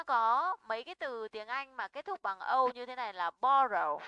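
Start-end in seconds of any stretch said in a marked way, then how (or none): 3.4-4.0 borrow with a loud BO; stress on first syllable. Shown as a demonstration. Teacher gives an example verb, borrow stressed on BO. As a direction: punch BO, let the row of borrow fall away. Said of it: "trọng âm rơi vào bo".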